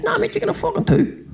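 mock the pushy teammate in a squeaky voice; a silly high-pitched mate; Gal's voice. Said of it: "And Gal goes".

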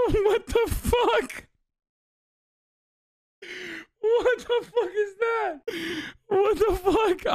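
high, slow, stunned squeak; laughing disbelief. Real, not acted. Streamer reacting to an absurd slur, high stunned disbelief. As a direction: stretch it out in shocked amusement, way up high.

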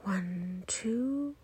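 soft count, clearly rising on two; inhale count. Shown as a demonstration. Guide counting two seconds of breathing in, rising count. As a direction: count gently upward as the breath fills.

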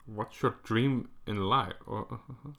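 normal talking voice

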